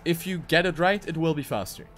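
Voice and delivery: ordinary talk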